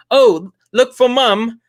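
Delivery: high, loud, slow mock English accent; hammed-up British impersonation, words stretched out. Imitating the English family on the ride, English accent. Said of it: "talking with these shitty English accents".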